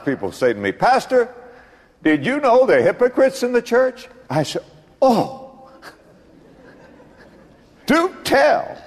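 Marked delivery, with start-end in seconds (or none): 0.7-4.1 mock parishioner. Parishioner quoted, high mocking voice. 5.0-8.8 high, mock-surprised with a laugh; a laughing, high "do tell"; sarcastic. Pastor's sarcastic laughing reply.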